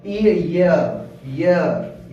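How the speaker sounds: teacher shows the pure sound of ईयर; correct pronunciation. Shown as a demonstration. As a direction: model ईयर with a long ee. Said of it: "ईयर बोलते हैं शुद्ध उच्चारण में".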